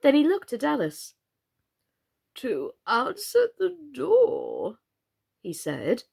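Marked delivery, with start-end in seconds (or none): 2.3-4.8 very slow, croaky drawl; slow frog drawl. A narrator's reading. Old frog explains to Alice in a slow drawl. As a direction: stretch every word lazily. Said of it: "this slow drawl in which he spoke".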